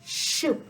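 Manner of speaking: "shoop" whispered, air escaping from the tongue sides; lateral lisp. Shown as a demonstration. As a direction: hiss "shoop" slushy out the sides. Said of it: "soup will sound like shoop".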